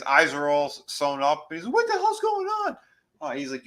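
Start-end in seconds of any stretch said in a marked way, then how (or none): 1.7-2.8 very high-pitched confused outcry; mock bewildered. Recapper as tripping man who can't see, mock bewildered. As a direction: squeal it in comic bewilderment. Acting out blinded character's confusion.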